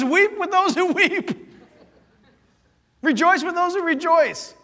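high, loud, slow preaching. Acted. Pastor proclaiming scripture to the church, high and slow.

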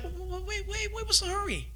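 high, soft stammering plea; a hint of panicked dad calling after them; lightly played worried father; anxious parent. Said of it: "And it's like".

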